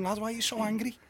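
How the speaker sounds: softly reasoning with an angry lad; retold calming down. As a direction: talk him down quietly. Retold attempt to calm angry man.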